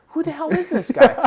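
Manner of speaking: high, loud puzzled question; baffled. Retold or imitated. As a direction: sound completely thrown. Confused by the stranger.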